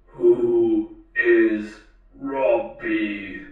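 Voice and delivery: very slow monotone robot, no intonation; mechanical robot voice. A narrator's reading. The talking robot answers the girl, toneless mechanical voice. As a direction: drag out each word like a machine. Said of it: "a mechanically timbered voice".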